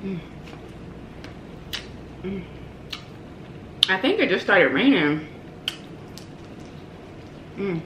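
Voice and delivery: chewing between words; talking between bites; mouth full. Real, not acted. Seen 3: picks food by hand, bites and chews throughout words.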